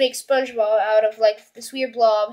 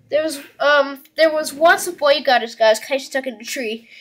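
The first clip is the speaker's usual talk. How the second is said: ordinary talk, nothing marked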